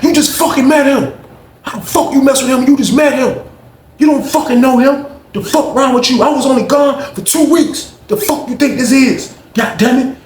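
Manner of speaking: high, loud, outraged shout; furious yelling. Retold or imitated. Neil yelling at Zach for siding with Josh, furious shout. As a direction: tear into him, outraged.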